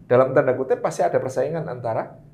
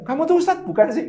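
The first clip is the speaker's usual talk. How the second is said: mock nagging wife; 11 semitones higher than usual. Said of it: "belum pernah dikatain".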